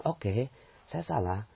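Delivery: slow, soft accepting admission; calm acceptance. Shown as a demonstration. Preacher shows how to calmly accept being robbed. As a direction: say it slowly, letting it go. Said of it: "ya kita bilang".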